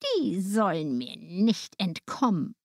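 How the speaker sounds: slow, gloating witch's thought; malicious. A narrator's reading. Witch plotting as the children come near, malicious. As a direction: relish it slowly and wickedly. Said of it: "lächelte sie boshaft und dachte".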